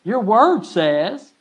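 loud, slow and pleading to God; bold prayer. Shown as a demonstration. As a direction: call out to God, dragging the words. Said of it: "it's okay for you to say".

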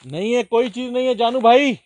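loud, high shout into the distance; calling out. Real, not acted. Explorer calling back to Janu bhai, shouting. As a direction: report back at the top of your voice. Calls back from far ahead alone.

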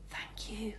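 soft tender whisper. A narrator's reading. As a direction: breathe it to the rat. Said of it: "she whispered to him".

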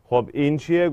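usual speaking voice

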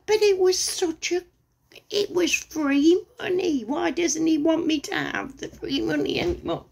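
high, whiny mock disappointment; hammed-up petulant whine; mock whining. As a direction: sulk like a child denied a treat. Mock sulking.

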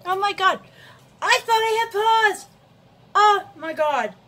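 high, loud shriek of realization; real, high-pitched loud surprise; startled outcry. Real, not acted. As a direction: exclaim in mortified shock. Realizes recording was never paused.

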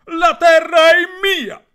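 loud, high, slow claim; possessive yell. Acted. Piero loudly claims the land as his own, slow high yell. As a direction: stretch each word, shouting. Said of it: "urlò Piero".

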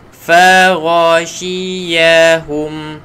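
Fa, ra, shi, ya, hum spelled out slowly; teacher drilling syllables one by one. Shown as a demonstration. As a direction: break the word into syllables. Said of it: "huruf ra dekat halkum".

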